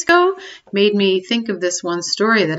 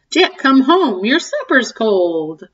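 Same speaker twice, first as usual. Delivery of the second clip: higher, louder mock-scolding call, then slower; scolding mom; 4 semitones higher, 6 dB louder than usual. Mother scolding Jack home for supper, mock scold. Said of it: "Mom called him with a scold".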